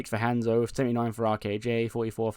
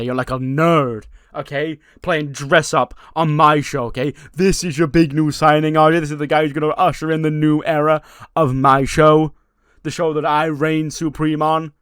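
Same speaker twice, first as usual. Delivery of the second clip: sneering insult; 4 semitones higher, 10 dB louder than usual. Retold or imitated. Johnny mocking Danhausen.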